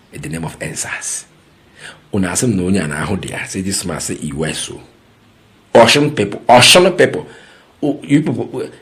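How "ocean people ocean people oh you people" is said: much louder, higher call to the crowd; shouting to the people of Osun about their governor. Real, not acted. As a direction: hail the Osun people across a distance.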